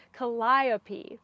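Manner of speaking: Calliope flowing as kuh-LIE-uh-pee, accent on LIE; correct pronunciation. Real, not acted. Knitter savoring the corrected name, Calliope as kuh-LIE-uh-pee. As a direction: say Calliope with confidence, second syllable stressed. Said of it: "prettier when said correctly".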